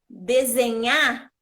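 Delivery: desenhar misread as "desenhá", loud stressed NHA; slow desenhar, no R, stress still on the end; leitura sem R. Shown as a demonstration. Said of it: "mesmo sem o R". ("desenhar" lido "desenhá", forte no final)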